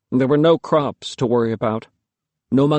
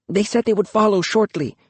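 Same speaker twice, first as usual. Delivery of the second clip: put-on young girl voice; 8 semitones higher than usual. A narrator's reading. Girl Luki answers father.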